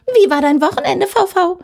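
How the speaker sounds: called out high and loud across the cubicles; yelling. Acted. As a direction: holler the greeting over the partition. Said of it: "sie brüllte".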